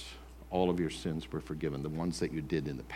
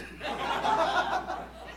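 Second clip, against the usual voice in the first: airy mocking giggle; breathless high laugh. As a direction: giggle under your breath in scorn. Accuser mocks drummer.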